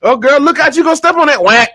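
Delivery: put-on high, excited girlfriend chatter; gossipy women. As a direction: gush like a delighted church lady. Women voiced in heaven joke.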